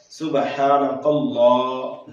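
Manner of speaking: slow सुबहानकल्लाह with a long ल; careful elongated recitation; lengthened lam. Shown as a demonstration. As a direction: stretch the ल in सुबहानकल्लाह. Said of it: "लाम को लंबा करना है".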